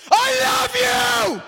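long, drawn-out, very high full-throated shout; screaming as loud as he can. Acted. God on the cross screaming his love, all-out shout. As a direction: bellow it with everything you've got, slow and stretched. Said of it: "God screaming as loud as he can".

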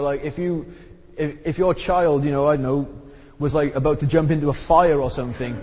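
ordinary talk, nothing marked

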